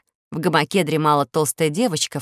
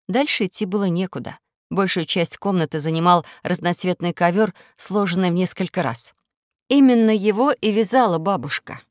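plain reading voice

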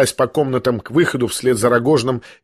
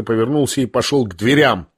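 usual speaking voice

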